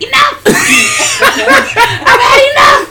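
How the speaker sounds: high, loud "enough" shouted through laughter; outraged shout. Retold or imitated. Katama storming out of the movie, a high laughing shout. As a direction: yell it like you've had it. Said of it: "Katama was like".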